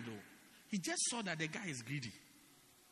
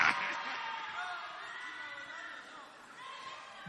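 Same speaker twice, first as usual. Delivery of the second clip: big burst of laughter; 10 semitones higher, 3 dB quieter than usual. Real, not acted. Laughing at own joke.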